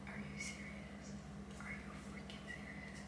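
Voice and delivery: voiceless whisper of disbelief; whispered exasperation, hardly any breath behind it; stunned whisper. Retold or imitated. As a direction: mouth it in a barely audible whisper. Stunned that headboard won't fit.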